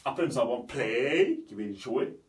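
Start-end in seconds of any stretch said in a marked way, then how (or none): 0.6-1.4 "play" with a long, rising "ey" at the end; English AY sound. Shown as a demonstration. Teacher reading the next example, drawn-out AY. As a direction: stretch the vowel of "play" upward. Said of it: "ça donne le son A".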